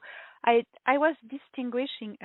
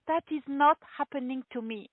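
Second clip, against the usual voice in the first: higher-pitched, giggly mock disbelief; acted disbelief; 6 semitones higher than usual. Telling how she met the commander, in mock disbelief. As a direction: replay being overwhelmed, pitch lifted. Acts out her disbelief at meeting.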